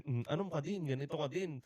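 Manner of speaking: softly muttered mock comeback; behind-the-back retort. Worker answering the boss behind his back, muttered mock retort. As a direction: grumble it under your breath, half-playfully. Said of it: "Tapos pag nakatalikod".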